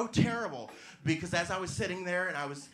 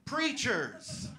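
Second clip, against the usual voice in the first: preacher echo; 7 semitones higher than usual. Pretend. Comic repeating the word, preacher-style echo. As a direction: echo it like an amen. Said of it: "Yeah, sorry".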